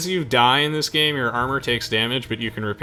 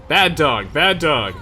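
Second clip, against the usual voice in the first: loud, higher-pitched playful telling-off; mock scolding; 5 semitones higher, 9 dB louder, much slower than usual. Player telling off an enemy in game, loud mock scolding. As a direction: scold it like a naughty pet, loudly.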